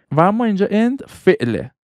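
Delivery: usual speaking voice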